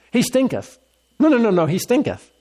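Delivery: a hammy, high-pitched protest; hammed-up Martha. Shown as a demonstration. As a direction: play up the old King James line for fun. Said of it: "it's just much more fun".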